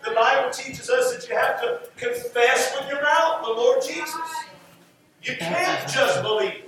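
ordinary talk

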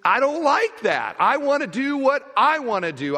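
put-on a sulky child refusing orders; the selfish heart pushing back on God's commands, high and whiny; petulant self. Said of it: "for you to say that I gotta do this is".

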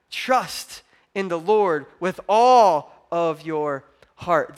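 very slow, weighted words; slow emphatic recitation. Acted. Pastor pressing the verse on the church, slow and weighted. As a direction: lay each word down slowly. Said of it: "slow emphatic scripture".

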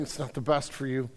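hushed, loving reassurance from above; loving voice of God. Retold or imitated. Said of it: "the voice of God is just lovingly saying".

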